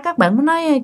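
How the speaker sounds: usual speaking voice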